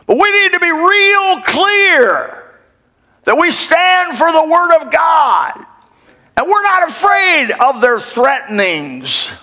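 preacher rising to a fervent shout. Real, not acted. Preacher builds to fervent climax.

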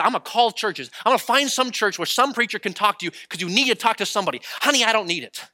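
acting out an exasperated couple; retold marital argument. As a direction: insist and push back like a bickering couple. Retold husband and wife arguing.

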